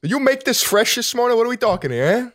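high, pushy New York wiseguy; Italian-American deli customer. Acted. Customer asking Tony about the food, pushy Italian-American.

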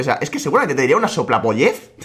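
ordinary delivery

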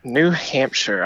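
each word drawn out slowly; slow repeat. Real, not acted. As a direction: slowly repeat the state, thinking it over. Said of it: "contestant repeats state slowly".

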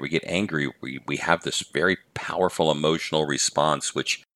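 ordinary talk, nothing marked

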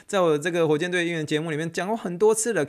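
usual speaking voice

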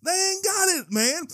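high, loud, worked-up preacher; excited preaching. Real, not acted. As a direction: pitch climbing into excited shouts. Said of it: "oh, I'm getting excited".

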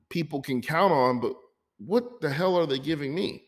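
usual speaking voice

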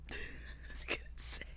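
breathy whispered aside; whispering. Real, not acted. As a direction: drop to a whisper. Whispered aside before pun.

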